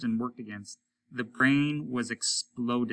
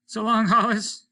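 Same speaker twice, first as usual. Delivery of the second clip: faint farewell; 11 semitones higher, 8 dB louder than usual. A narrator's reading. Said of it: "Stone's voice very faint now".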